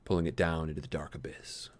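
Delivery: breathy whispered ending. A narrator's reading. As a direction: whisper the fall into darkness. Story reaches its grim ending.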